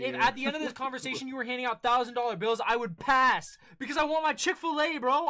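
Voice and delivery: loud, high fed-up rant; exasperated rant. Real, not acted. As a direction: rant about wanting food.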